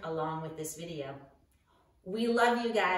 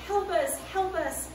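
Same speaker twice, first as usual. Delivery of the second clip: higher, louder call of help us; mock cry for help; 10 semitones higher, 4 dB louder than usual. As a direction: act out scared disciples calling. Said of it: "like, yell out and say".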